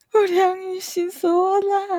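high, drawn-out giggly protest; laughing disbelief. Real, not acted. Co-host reacting to the absurd quiz option, high laughing disbelief. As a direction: squeal it slowly through laughter.

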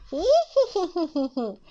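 high villainous snicker; long rippling hee-hee; scheming storybook cackle; sly fox laugh. A narrator's reading. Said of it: "小心眼笑着说".

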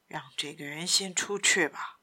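low, soft, half-whispered request; hushed Simon. Acted. Simon asking Patrice for privacy, hushed. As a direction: ask it low, for his ears only.

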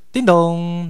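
imitated doorbell. Visitor ringing at the company door, imitated chime.

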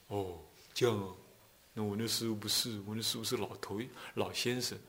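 a soft, deflated little answer; meek quiet reply. Retold or imitated. A plain Buddha-reciter answering a boastful friend, softly. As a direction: hush down, sound outdone and humble.

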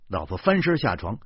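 ordinary delivery

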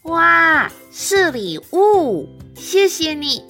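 high, loud happy thanks; delighted. Acted. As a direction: squeal over the gift. Cindy receives a gift.

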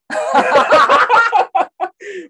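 high, loud burst of laughter; big laugh. Real, not acted. Laughs hard at the laid-back coworker's excuse, high and loud. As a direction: explode laughing.

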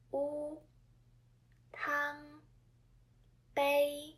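"u", "tang", "bei" each held on a high level pitch; first tone. Shown as a demonstration. Teacher recalling last episode's examples, first tone held high and level. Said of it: "first tone of Chinese intonation".

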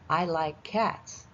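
very slow, each word clear; slow teaching read. Shown as a demonstration. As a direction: spell it out slowly for kids. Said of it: "这里cats一样要加s".

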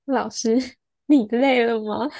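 slow teasing question, laughing through it; mock-sweet jab while chuckling; laughing sarcasm. Student teasing the tired teacher, laughing sarcastically.

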